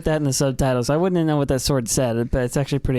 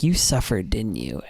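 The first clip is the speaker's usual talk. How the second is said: tender sympathy; 6 semitones lower than usual. Retold or imitated. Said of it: "and he's like".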